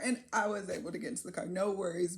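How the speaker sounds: ordinary talk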